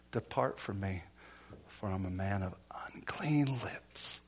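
low, soft, retold plea; hushed and low, sinking away; humbled confession. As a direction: quietly imitate a man confessing his unworthiness. Retells Peter's humbled confession at Jesus' feet.

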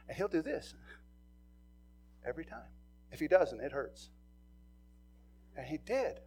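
usual speaking voice

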